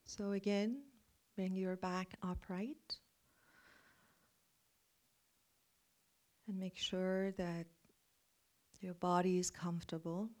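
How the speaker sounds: guided meditation. Acted. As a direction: guide them into calm reflection.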